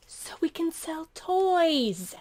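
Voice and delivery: high, drawn-out evil-scientist voice. Acted. Scientists plotting to fake dinosaurs, hammed-up evil scientist. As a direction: stretch it out like a scheming villain. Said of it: "The evil scientists".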